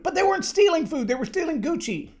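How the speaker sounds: "stealing" hit hard with rising indignation; indignant outburst. Real, not acted. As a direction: fire back at the excuse. Indignant correction of excuse.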